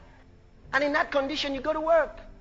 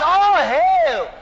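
loud, high, drawn-out shout; shouted hail; 4 semitones higher, 8 dB louder than usual. Acted. Preacher repeating Jesus's greeting, full shout. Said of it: "That's what he said".

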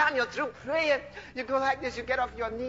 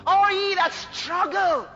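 high, slow, ringing scripture cry; drawn-out preacher's invitation, building loud; fervent preaching; 6 semitones higher, 5 dB louder than usual. Acted. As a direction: call the burdened to come. Preaches scripture at full force.

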